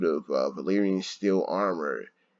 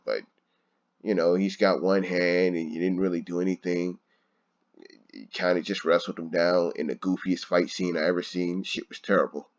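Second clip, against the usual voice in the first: usual speaking voice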